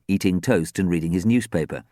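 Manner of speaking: plain reading voice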